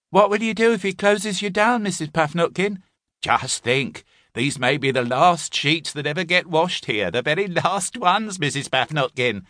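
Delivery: high mock-solemn teasing; goading lads. A narrator's reading. Lads goading the landlady, lightly voiced teasing. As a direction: needle her with fake gloom. Bigger lads goad her.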